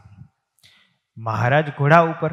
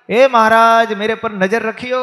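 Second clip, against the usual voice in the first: loud, high shout trailing off on the plea; shouting after him; 9 semitones higher, 8 dB louder than usual. Retold or imitated. Woman shouts to Maharaj now far off, loud call. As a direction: yell it down the road after someone far away. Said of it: "બાએ રાડ નાખીને કીધું".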